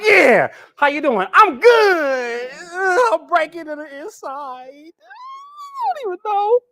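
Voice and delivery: high, loud, whimpering fake sob; mock crying. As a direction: blubber through the words as a fake weep. Dove pretending fine, then crying.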